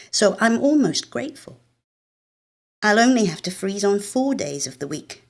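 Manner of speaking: ordinary delivery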